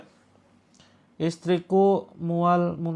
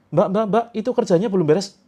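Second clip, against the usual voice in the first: calling out; 6 semitones higher, 5 dB louder than usual. Retold or imitated. Boss calling worker back.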